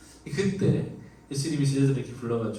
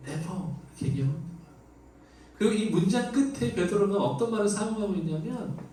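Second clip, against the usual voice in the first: normal talking voice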